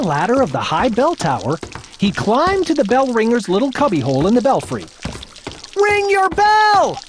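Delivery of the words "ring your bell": much higher, slow loud call. Acted. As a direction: call up to him. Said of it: "he called".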